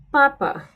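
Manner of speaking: papa said slowly, unaspirated p; Spanish P. Shown as a demonstration. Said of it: "The next letter after O is P".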